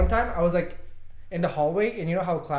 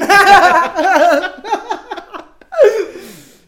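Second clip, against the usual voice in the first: long loud laugh; 16 dB louder than usual. Real, not acted. Big laugh.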